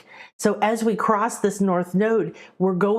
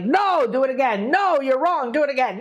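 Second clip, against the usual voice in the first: strict teacher; 5 semitones higher than usual. Retold or imitated. Healer teacher ordering her apprentice to retry, stern and insistent. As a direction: bark it back like a drill master. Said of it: "she would be like".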